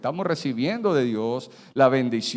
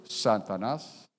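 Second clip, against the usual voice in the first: ordinary delivery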